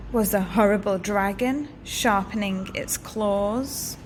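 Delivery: plain reading voice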